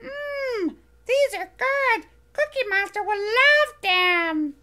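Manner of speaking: high, bright little furry monster voice, eager; squeaky childlike monster, proud; Elmo voice. Acted. Elmo tasting cookies.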